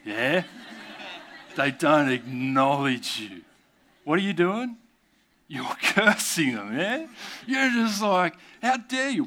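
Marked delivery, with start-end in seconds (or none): 4.0-9.2 high, indignant mock outrage; mock road rage. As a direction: play the offended driver, hammed up.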